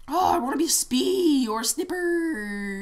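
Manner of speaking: slow, loud, drawn-out whine; hammed-up pleading wail; mock whining. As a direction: stretch the words like a silly complaint. Mock whining with silly mispronounced classes.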